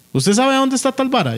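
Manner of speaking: lightly voiced Trickster; Trickster asking. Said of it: "Y el mae".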